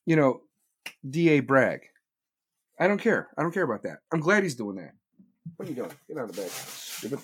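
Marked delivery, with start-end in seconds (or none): 5.6-7.2 much softer, scolding aside to the dogs; muttering at the dogs. Real, not acted. Speaker turns from the stream to scold his dogs, much softer scolding. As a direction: tell the dogs off quietly, half under your breath.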